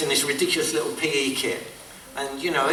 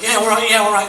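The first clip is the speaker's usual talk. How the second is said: acted retort; 8 semitones higher, 5 dB louder than usual. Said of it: "you know, he'd say".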